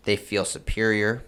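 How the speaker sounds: normal talking voice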